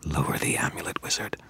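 soft menacing whispered command; snarled whisper. Acted. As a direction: order him in a hiss. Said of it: "The vampire snarled".

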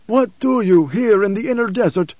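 put-on high, forceful old prophet; questioning preacher. A narrator's reading. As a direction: interrogate the stranger insistently. Blind preacher figure questioning a stranger.

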